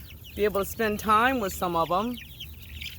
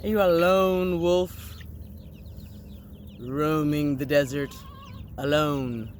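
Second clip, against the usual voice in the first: mock dramatic; 3 semitones lower than usual. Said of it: "A little drama".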